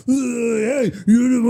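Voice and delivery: high, loud hammed-up yell; mock fan outburst. Acting out meeting Ray Allen, mock fan outburst. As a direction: playfully holler at a celebrity. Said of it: "So what I'll do when I see him is".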